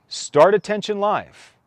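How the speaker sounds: loud, high, clear command to a smart speaker. Shown as a demonstration. Consumer commanding a smart speaker, loud and high. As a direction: bark it at the home device like a command. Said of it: "make verbal requests of their home devices".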